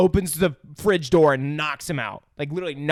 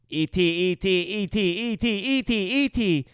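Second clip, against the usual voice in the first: raised, repetitive alien chanting; going crazy; 5 semitones higher, faster than usual. Retold or imitated. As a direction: babble it over and over, mock-manic. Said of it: "starts going crazy".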